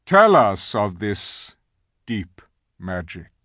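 slow, commanding lion voice; grave lion. A narrator's reading. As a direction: command it slowly and solemnly. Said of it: "answered Aslan gravely".

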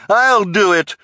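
very high, loud cry; shrieky bid to be chosen. A narrator's reading.

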